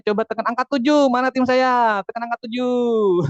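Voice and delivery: rally the audience to respond; loud, playful roll call. Real, not acted. Calls out to own team.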